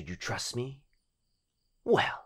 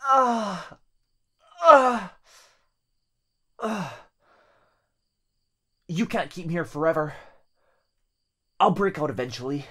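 higher, louder, fast defiance between strained grunts; defiant boyfriend; 10 semitones higher, 12 dB louder than usual. Acted. Trapped boyfriend defying the demon, strained fast shout. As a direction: fight the bindings.

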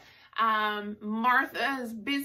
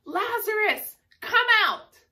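much higher, louder commanding call; 10 semitones higher, 7 dB louder than usual. Retold or imitated. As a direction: call him out of the tomb with authority. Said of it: "And then in a loud voice".

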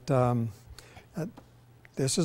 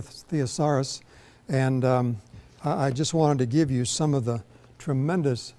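usual speaking voice

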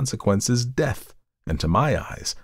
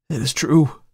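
plain reading voice

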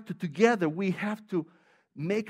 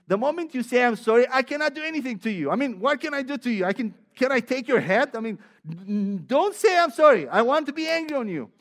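higher, louder, fast, mock-annoyed; mock frustration; 5 semitones higher, 7 dB louder than usual. Angry coworkers retold, hammed-up frustration. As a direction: play the disarmed angry person, hammed up. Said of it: "Because they say".

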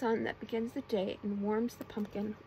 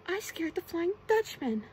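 higher, louder exclamation; excited cry; 6 semitones higher, 5 dB louder than usual. A narrator's reading. SpongeBob boasting of scaring the Dutchman, crying out. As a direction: crow it in storybook triumph. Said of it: "cried SpongeBob".

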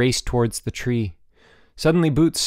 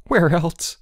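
raised, nervy retort; shaky voice; 6 semitones higher, 4 dB louder than usual. A narrator's reading. Boots clinging in the tree to Bruno, shaky. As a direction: answer shakily, pitch up. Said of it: "a shaky voice replied".

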